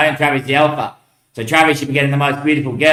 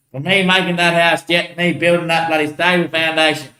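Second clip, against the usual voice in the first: normal talking voice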